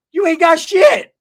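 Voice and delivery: high, loud, slow scoff; mock outburst. Mocking the tier tender's offer.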